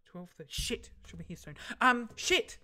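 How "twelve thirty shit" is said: usual speaking voice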